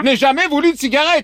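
high mock protest; protesting patient. Prankster plays the protesting patient.

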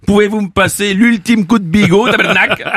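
jokey Quebec-ish twang on tabernacle; mock Belgian accent. Host asking the switchboard for the last call, mock Belgian accent. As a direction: put on a hammed-up Belgian accent. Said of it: "je prends l'accent belge".

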